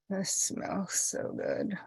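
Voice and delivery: hushed, very slow relish; soft, drawn-out swoon of appreciation; savouring softly. Real, not acted. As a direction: sink into a lovely scent. Savouring a scent she inhales.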